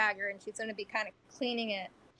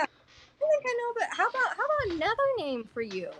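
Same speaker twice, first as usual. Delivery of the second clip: higher voiced character; 9 semitones higher than usual. Player character prods the goblin in a higher voice. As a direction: coax out a name in a raised voice.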